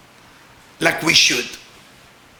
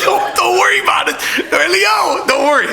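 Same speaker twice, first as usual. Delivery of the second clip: raised, high-pitched club-owner reassurance; high, insistent mock reassurance; hearty reassurance; 9 semitones higher than usual. Acts out club owner brushing off worry.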